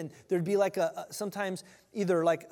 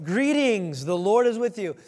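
booming angel; 3 semitones higher, 8 dB louder than usual. Retold or imitated. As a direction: announce it like a herald. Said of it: "the angel Gabriel shows up".

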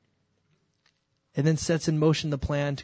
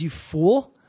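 loud, stern rebuke; louder, indignant correction; 3 semitones higher, 8 dB louder, much slower than usual. Retold or imitated. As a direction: scold the fool with sudden force. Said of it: "And Paul says".

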